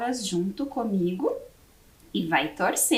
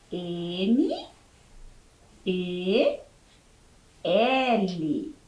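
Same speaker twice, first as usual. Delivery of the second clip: each letter said on its own, long pauses between; dictating letters to a class; spelling out letters; 3 semitones lower, much slower than usual. Shown as a demonstration. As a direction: spell it slowly, letter by letter, for young pupils. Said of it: "Como eu escrevo mel?".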